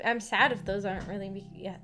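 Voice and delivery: normal talking voice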